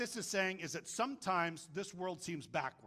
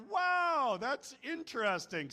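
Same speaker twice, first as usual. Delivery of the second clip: drawn-out, high, loud wow; amazed reaction; 7 semitones higher, 5 dB louder, slower than usual. Retold or imitated. Preacher acting out his reaction, mock amazement. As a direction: exclaim it slowly, pitched way up.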